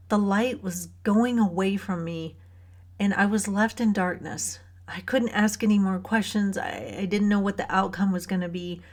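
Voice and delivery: ordinary talk, nothing marked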